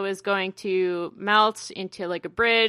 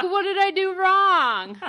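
high, plaintive put-on voice; hurt boyfriend asking what he did; whiny clueless guy; 8 semitones higher than usual. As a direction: play innocent and baffled. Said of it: "and he's like".

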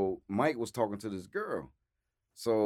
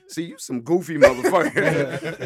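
laughing; 5 semitones higher, 7 dB louder than usual. Real, not acted. Quoting Mike while laughing.

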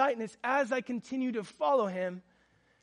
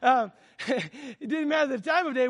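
laughing retake; 3 semitones higher, 4 dB louder than usual. Shown as a demonstration.

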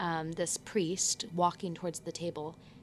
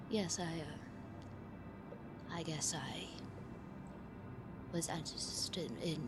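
the professor, nervous, speaking much softer and hesitant; nervous professor, hushed; 7 dB quieter, slower than usual. Acted. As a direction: confess it quietly, afraid of being overheard. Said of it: "and he goes".

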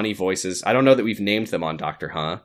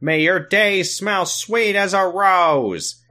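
Sam voice; 8 semitones higher, much slower than usual. Acted. Host leading the congregation in a prayer, hammed-up character voice. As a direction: intone it grandly, stretching each word. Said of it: "in Sam voice, please".

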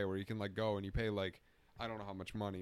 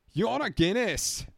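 squeaky high Irish masseuse voice, much louder; high mock Irish lady; 13 semitones higher, 10 dB louder than usual. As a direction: squeal it like a hammed-up Irish barmaid.

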